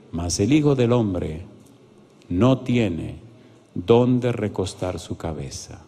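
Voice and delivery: slow, low, measured reading; solemn scripture reading. Acted. Pastor reads Jesus' reply to the scribe, solemn and slow. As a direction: let each phrase land, low and slow.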